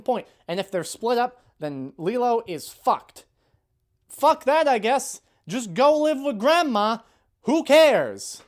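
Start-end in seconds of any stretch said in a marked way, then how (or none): 4.1-8.4 loud, high-pitched exasperated scorn; angry sarcastic dismissal. Real, not acted. Ranting at the remake's writers, loud bitter sarcasm. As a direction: throw it out loud, furious and sarcastic.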